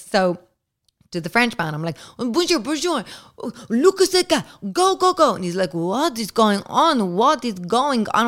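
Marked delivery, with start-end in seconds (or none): 2.2-5.3 high, urgent, rushed pleading; panicked squeal to get him moving; frantic high panic. Retold or imitated. To the French man, shooing him out in high panic. 5.7-8.3 a hint of a bewildered French man, higher; lightly voiced baffled lover; confused French man. Naked French man asking what's happening, mildly baffled voice.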